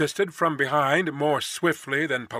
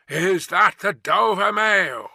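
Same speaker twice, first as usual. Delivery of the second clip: slow, raised calling voice; calling from afar; 5 semitones higher, 6 dB louder, slower than usual. A narrator's reading. Rider calling from the mist, slow hail. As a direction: hail them across a distance. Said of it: "a man's voice called from the mist".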